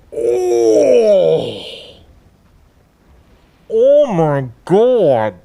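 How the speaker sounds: loud, drawn-out stunned cry; shocked outcry. Real, not acted. Gamer startled by a sudden attack, loud shocked cry. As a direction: cry out slowly in real alarm. Monster attack surprises the player.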